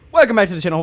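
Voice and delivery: loud, pumped-up welcome; booming YouTube intro; hyped channel intro. Real, not acted. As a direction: greet the viewers like a big show opener.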